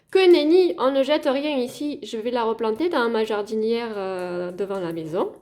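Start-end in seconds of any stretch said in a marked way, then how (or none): 0.1-2.0 high, loud mock-indignant veto; playful, emphatic protest, pitched up; emphatic refusal. Real, not acted. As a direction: burst in to overrule the advice. Rebutting advice to throw away.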